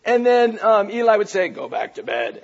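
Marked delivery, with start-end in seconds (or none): 1.5-2.4 low, quiet dismissal from bed; Eli. Acted. Eli lying down sends Samuel away, low and quiet. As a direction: drop it low and soft, sending the child off. Voicing old Eli sending boy back.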